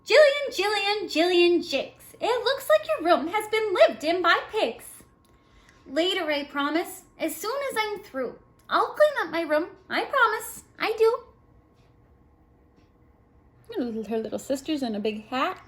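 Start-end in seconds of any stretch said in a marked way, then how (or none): 0.0-11.2 high, loud sing-song read-aloud voice; voiced rhyme dialogue. A narrator's reading. Reading the child's rhyme to kids in a high voice. Mother scolds, Jillian answers.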